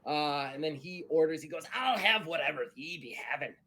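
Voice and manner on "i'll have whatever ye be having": lightly voiced pirate; a touch of pirate in the order. Pirate ordering at the bar, lightly hearty.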